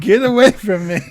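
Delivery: cat pushing its owner away. Pretend. Voicing the cat for a friend, high loud cartoon voice.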